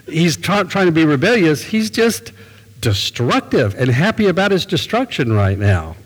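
normal talking voice

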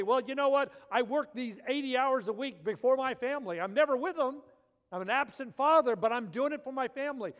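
loud, high mock excuse of a busy dad; quoted busy father. Pastor mimicking men who neglect family, loudly. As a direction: throw the working man's excuse back at them.

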